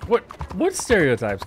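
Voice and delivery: high, loud incredulous what; outraged, disbelieving question; indignant disbelief. Real, not acted. Indignant at chat's suggestion.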